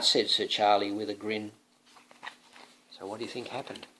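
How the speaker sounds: ordinary delivery, nothing marked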